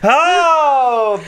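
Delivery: high loud "oh" of outrage; disgusted surprise. Real, not acted. Reacting to the worst character, loud high outrage. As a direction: recoil at a hated name. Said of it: "reaction to villain's name".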